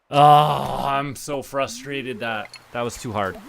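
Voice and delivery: usual speaking voice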